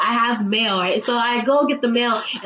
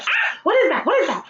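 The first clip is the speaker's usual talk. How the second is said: startled high yelp; small scream; 10 semitones higher, faster than usual. Retold or imitated. Woman spotting a dark clump on her bed, startled yelp. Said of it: "I scream a little".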